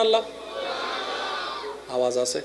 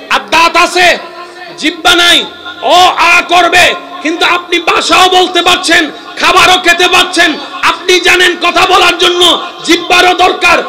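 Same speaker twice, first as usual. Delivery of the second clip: high, loud shouting sermon; fiery preacher at full voice; full-force preaching; 7 semitones higher, 7 dB louder than usual. Acted. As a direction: hammer each point to the crowd.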